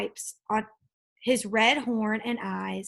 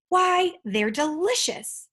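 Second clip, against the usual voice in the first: high, slow delighted yell; 7 semitones higher, 4 dB louder, slower than usual. A narrator's reading. Dragon jumping up after tasting peel, yelling. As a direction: cry out in happy surprise. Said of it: "jumped up yelling".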